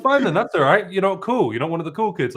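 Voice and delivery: mock sulk. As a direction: pretend to be hurt, playfully. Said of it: "I'm like".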